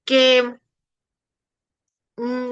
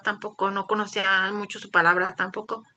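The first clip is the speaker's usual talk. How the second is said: ordinary talk, nothing marked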